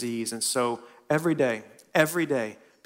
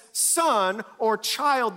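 tender; 8 semitones higher, 3 dB louder, much slower than usual. Acted. Jesus tenderly addressing man.

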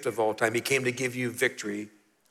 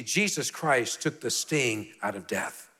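ordinary talk

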